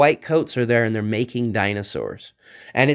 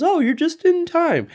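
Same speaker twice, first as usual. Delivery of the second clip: much higher, eager scientist voice; Doctor Wu greeting. Retold or imitated. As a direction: greet them brightly, pitched way up. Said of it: "he comes up with his clipboard and says".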